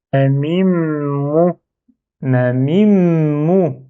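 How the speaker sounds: Ma mim mou spaced out very slowly, syllable by syllable, the m of mim held into mou; slow syllable dictation. Shown as a demonstration. Teacher dictating a new word to learners, very slow and spaced. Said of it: "Premier mot".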